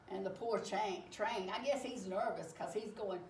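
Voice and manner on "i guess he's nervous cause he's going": usual speaking voice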